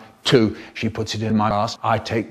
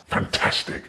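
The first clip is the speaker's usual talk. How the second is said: breathy whispered "Fantastic". Acted. Comic narrator whispering his catchphrase. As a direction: whisper the word like a secret.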